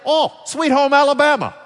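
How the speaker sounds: high, loud mock-excited cry; imitating excited locals. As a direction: exclaim it like a delighted stranger recognising a song. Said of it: "You know what they say?".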